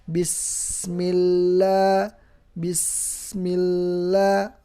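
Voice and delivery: bismillah slow, lam and sin both held. Shown as a demonstration. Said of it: "Cuman sin lebih panjang". (bismillah dengan lam dan sin ditahan)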